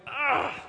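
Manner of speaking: retold frustrated "ah!"; angry "ah". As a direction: imitate a flash of anger in a wordless cry. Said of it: "had this flash of, like, anger".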